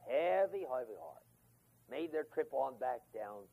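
ordinary talk, nothing marked

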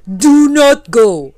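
loud, high, slow warning; warning lightning. Acted. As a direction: forbid it in a drawn-out, booming call. Lightning warns the traveller.

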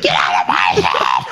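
low, growled mock shout; mock outburst. Host teased by friend, low mock shout. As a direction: roar it as a joke, half laughing.

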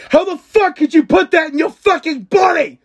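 high, loud mock fury; mock Gordon rage. Gordon yelling at a cocaine maker, mock furious. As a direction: rant like an outraged chef. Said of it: "And Gordon's like".

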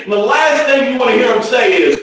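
normal talking voice

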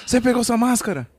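put-on caring girlfriend. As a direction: check on him like a worried girlfriend. Voicing girlfriend's caring check. (namorada cuidadosa)

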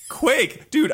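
high, punchy cry of the name; excited exclamation. Real, not acted. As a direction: shout the name with glee. Excited recognition of game.

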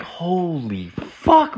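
slow, high, drawn-out holy fuck; stunned disbelief. Retold or imitated. To his friend about the profile, slow stunned disbelief. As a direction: reel at what he just read.

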